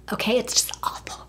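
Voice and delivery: hushed, voiceless whisper; whispered aside. Real, not acted. Confiding to viewers about her boyfriend's flaws, whispered. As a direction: drop to a whisper for emphasis. Same words repeated in a whisper.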